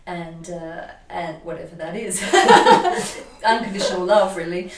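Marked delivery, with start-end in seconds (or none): 2.3-3.4 sudden high burst of laughter; bright high laugh breaking out. Real, not acted. Laughing at unconditional love.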